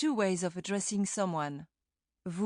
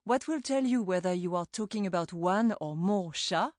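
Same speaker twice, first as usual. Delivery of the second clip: usual speaking voice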